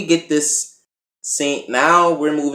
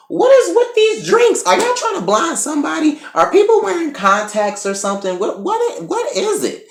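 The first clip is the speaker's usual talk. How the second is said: incredulous high-pitched rant; 3 dB louder than usual. Real, not acted. Ranting about drink-throwing on the show.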